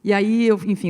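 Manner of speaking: usual speaking voice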